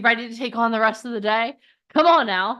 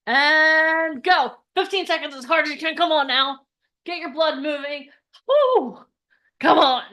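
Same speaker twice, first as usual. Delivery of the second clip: energetic coach shout; 5 semitones higher, 4 dB louder than usual. Real, not acted. Coaching audience exercise.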